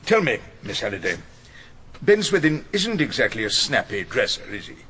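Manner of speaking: ordinary delivery, nothing marked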